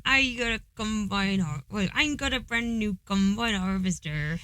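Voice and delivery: broad, rustic West Country burr; West Country accent. Shown as a demonstration. Joining partner's accent demo, broad West Country accent. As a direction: roll out the hard R's, West Country. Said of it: "who is from West Country".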